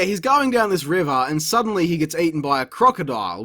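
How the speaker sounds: normal talking voice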